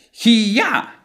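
Hiya as a high martial-arts yell on the yah; exaggerated ending. Shown as a demonstration. Teacher mocking how not to say here, exaggerated ending. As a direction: blow up the end of Hiya like a fighter's cry. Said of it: "ending sound is not exaggerated. Don't go around saying like".

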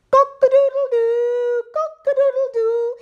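high, loud crowing like a rooster; high rooster cock-a-doodle-doo. Acted. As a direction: crow it out at the top of your voice. Animals charge the robbers.

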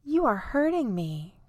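high ouch, mildly pained complaint; pained cry. A narrator's reading. Poor island hurt as Lucy helps him, pained cry. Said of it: "cries the poor island".

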